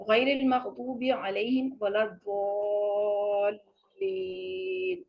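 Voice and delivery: chanted tajweed recitation, very slow; slow Quran recitation. Shown as a demonstration. Teacher recites the verse properly for students. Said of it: "یہاں ضاد کو جو ہے نا".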